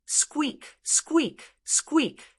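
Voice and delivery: high, strained mock squeaking; three high rodent squeaks; rat squeaks.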